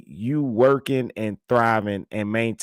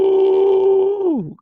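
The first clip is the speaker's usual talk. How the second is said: surprised ooh; 7 dB louder than usual. Real, not acted. Reacting to crying lady clip.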